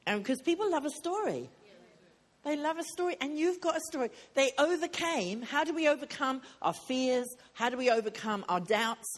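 fervent sermon delivery; preaching. Acted. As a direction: preach it out to the room. Preaching builds up.

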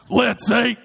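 loud, bright shout; cheery loud call to eat; sudden shout. Retold or imitated. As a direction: blurt it out to the table.

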